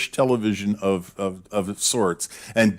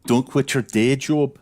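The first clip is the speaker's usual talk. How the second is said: Glaswegian retort; 3 semitones higher than usual. Retold or imitated. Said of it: "his response was".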